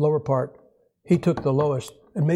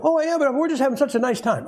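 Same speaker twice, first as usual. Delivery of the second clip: feigned enjoyment; 8 semitones higher than usual. Pretend. Host voiced feigning enjoyment.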